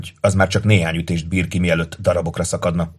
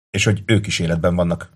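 ordinary delivery

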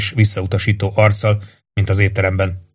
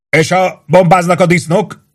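higher, louder, slow call from the back; shouted question from the crowd; 9 semitones higher, 4 dB louder than usual. A narrator's reading. A soldier shouts his worry at sergeant Warden, raised call. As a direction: yell it over the crowd. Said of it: "kiáltotta valaki".

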